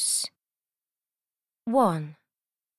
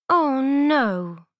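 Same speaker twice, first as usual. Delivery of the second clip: louder, higher cry of dismay; dismayed princess; 4 semitones higher, 5 dB louder than usual. A narrator's reading. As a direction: wail it as the ball drops. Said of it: "The princess says".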